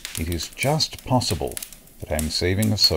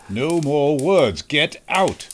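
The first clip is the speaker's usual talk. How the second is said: stern command; 7 semitones higher, much slower than usual. Acted. Holmes orders the thief away.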